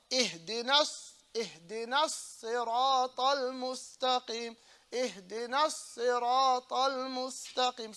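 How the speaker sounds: "اهدنا الصراط المستقيم" with a long s on الصراط; measured "اهدنا الصراط المستقيم", sad doubled; held sad. Shown as a demonstration. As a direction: hold the heavy s of "الصراط". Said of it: "So you hold the sad".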